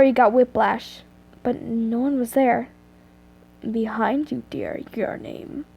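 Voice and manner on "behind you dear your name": low, hushed masculine voice; soft, slow and creepy, dropping almost to a whisper; deep whispering man. Acted. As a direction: murmur it slowly, right into the ear. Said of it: "this time deeper than before".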